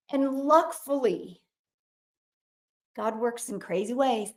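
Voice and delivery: ordinary talk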